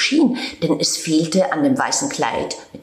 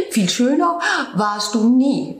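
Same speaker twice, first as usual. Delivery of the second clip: higher, mock-polite meadow voice; teasing meadow; 6 semitones higher than usual. Meadow teasing the snow-soaked cat, higher and mock-polite. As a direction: needle him with false courtesy.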